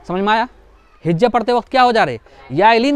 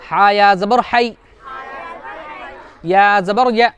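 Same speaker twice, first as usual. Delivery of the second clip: spelling drill; slower than usual. Shown as a demonstration. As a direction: chant each letter slowly for the class. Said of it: "उसके बाद देखो".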